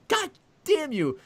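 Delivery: high squeaky frustrated curse; exasperated curse. Retold or imitated. To the clueless staffer, a high exasperated curse. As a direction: squeal it in disbelief.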